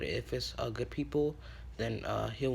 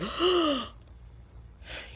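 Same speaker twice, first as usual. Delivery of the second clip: loud, high sucked-in gasp; sudden sharp intake of breath; big gasp; 4 dB louder than usual. Acted. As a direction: wake up with a huge, sharp gasp. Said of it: "takes a big gasp".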